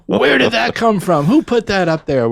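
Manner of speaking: high, loud mock outrage; mad older nurse. Older nurse discovering the picture, retold angry demand. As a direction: scold loudly, imitating an indignant nurse. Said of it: "she got so mad".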